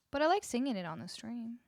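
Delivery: ordinary talk, nothing marked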